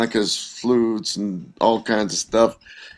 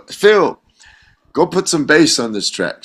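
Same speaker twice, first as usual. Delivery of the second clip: raised-voice instruction; calling out; 6 semitones higher than usual. Retold or imitated. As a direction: call across the studio to a bandmate. Studio people call out to Phil.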